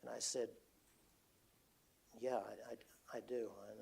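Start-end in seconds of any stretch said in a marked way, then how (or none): 2.2-3.8 hushed admission. Retold or imitated. Admitting fear to his crying wife.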